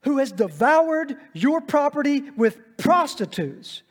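loud, high, bitter complaint; resentful son. Acted. Resentful older son protests loudly to his father, angry. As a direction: throw the grievance back at the father. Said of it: "look how he talks to his father".

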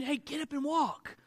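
lightly mocked timid healer; mock quiet command. Shown as a demonstration. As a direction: murmur it so nobody else hears. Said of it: "a little bit quieter".

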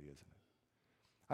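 voiceless hushed tag; tiny whispered aside. Acted. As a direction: breathe the question.

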